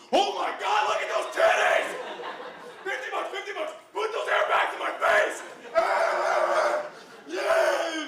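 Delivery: high, frenzied shouting; wild excitement. Retold or imitated. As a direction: lose all control and scream with glee. Uptight guy goes wild at stripper.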